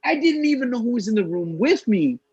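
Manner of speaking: high, exasperated retelling; pleading that it was just the movie; exasperated protest. Retold or imitated. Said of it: "and I'm like".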